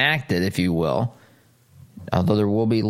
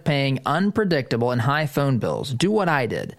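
upbeat, higher-pitched sponsor read; 4 semitones higher than usual. Acted. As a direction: pitch the service like a radio commercial. Host reads a phone service ad.

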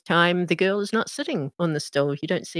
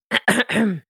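throat clearing; 6 semitones higher than usual. Acted. Someone clears throat behind Marie.

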